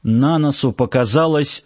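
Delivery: ordinary delivery, nothing marked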